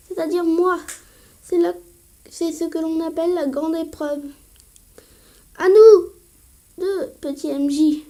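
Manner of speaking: voix de champion plus forte; doyen qui proclame. Acted. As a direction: annonce l'épreuve avec emphase. Doyen annonce l'épreuve. (doyen théâtral)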